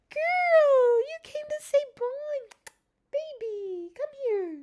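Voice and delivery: very high, gushing pet talk; coaxing the cat. Real, not acted. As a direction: squeal to lure your cat over. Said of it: "I do this to get their attention".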